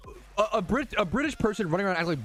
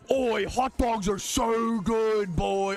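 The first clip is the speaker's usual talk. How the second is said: slow, drawn-out goofy gushing; mock American enthusiast; 2 semitones higher, slower than usual. Imitating an American raving about hot dogs, mocking. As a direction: stretch it out like a silly superfan. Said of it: "as if an American went around".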